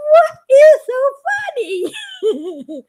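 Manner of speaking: laughing through it, high and slow; laughing question. Retold or imitated. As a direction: ask it while cracking up. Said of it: "I go".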